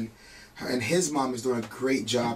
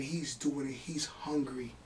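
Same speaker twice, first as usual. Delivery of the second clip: slow, much softer whispered words; hushed with emotion; teary whisper; 8 dB quieter, slower than usual. Real, not acted. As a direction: whisper it, moved to tears. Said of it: "makes me teary-eyed".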